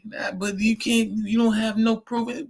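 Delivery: high, put-on protest; mock shoplifter. Shoplifter challenging store security for proof, high mock protest. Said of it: "And then be like".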